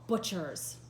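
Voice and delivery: loud, declaiming Shakespeare; dramatic reading. Acted. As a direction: read it out like a stage speech. Teacher reads Antony's speech dramatically.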